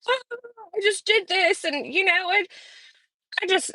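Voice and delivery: high-pitched mocking voice; mocking imitation. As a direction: mimic them in a put-on high voice. Mimics someone she is criticizing.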